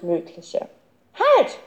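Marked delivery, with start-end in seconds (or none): 1.1-1.6 very high, abrupt cry; sudden call. A narrator's reading. Girl suddenly halting mid-hop, calling out. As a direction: stop everyone short with one shout. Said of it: "rief sie plötzlich".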